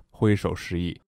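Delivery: ordinary talk, nothing marked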